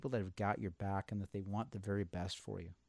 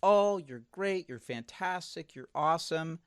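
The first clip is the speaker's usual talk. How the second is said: high, slow, syrupy mock praise; sugary fake cheer, much higher; mock flattery; 6 semitones higher, 4 dB louder than usual. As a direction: ham up the empty compliments. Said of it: "who is helping you by saying".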